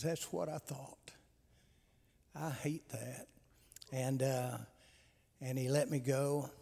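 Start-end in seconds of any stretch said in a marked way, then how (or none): 0.0-3.3 quiet and sad. Retold or imitated. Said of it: "he just got quiet".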